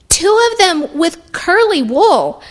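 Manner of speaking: put-on high, drawn-out childlike eagerness; high-pitched, slow, proud child; little boy voice. A narrator's reading. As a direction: chime in like a small boy. Boy adds detail eagerly.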